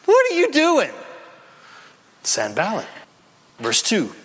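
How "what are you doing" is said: sneering enemy, much higher and louder; stinging mock. Enemy mocking a believer, high sneering taunt. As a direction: jeer it like a taunting foe. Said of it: "the stinging mock of the enemy".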